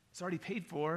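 mildly unsure cashier's explanation; understated, hesitant delivery; tentative. Retold or imitated. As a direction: say it small and uncertain. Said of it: "real tentative and a little bit like".

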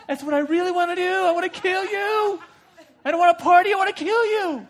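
high, loud, cackling kill you; gleeful crazed villain; manic villain. Acted.

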